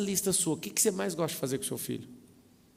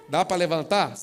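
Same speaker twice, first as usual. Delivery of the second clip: louder repeat; 8 dB louder than usual. Retold or imitated. Louder repeat over crying child.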